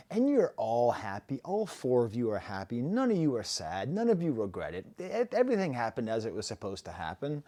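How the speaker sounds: ordinary delivery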